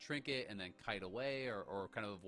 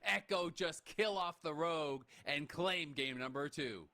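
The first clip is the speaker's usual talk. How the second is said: high, loud live commentary call; excited play-by-play; 8 semitones higher, 5 dB louder, slower than usual. Real, not acted. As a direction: call the finish like a caster. Caster calls the winning play.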